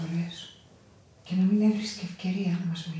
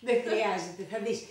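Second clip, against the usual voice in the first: ordinary talk, nothing marked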